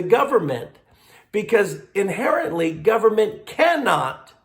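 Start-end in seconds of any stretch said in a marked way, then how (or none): 3.5-4.3 CANNOT pushed higher; stress on cannot. Real, not acted. Bishop arguing government may not tax closed businesses, stressing cannot. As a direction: hit the word cannot. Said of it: "I emphasize cannot".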